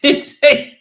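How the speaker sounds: a sudden loud laugh, high and bright; burst of laughter. Real, not acted. Laughing as he remembers the Aussie mate gloating. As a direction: let the laugh burst out at the memory.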